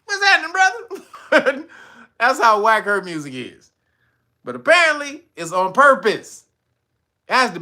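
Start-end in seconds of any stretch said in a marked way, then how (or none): 0.0-1.7 high, loud hype greeting. Real, not acted. Streamer greeting chat, high and loud. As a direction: shout a hyped hello to the chat.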